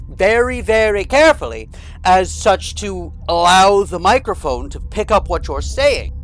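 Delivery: crisp Mid-Atlantic announcer diction, every word enunciated; Mid-Atlantic accent. Shown as a demonstration. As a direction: show off the old radio Mid-Atlantic way of speaking. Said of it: "you had to kind of talk like this".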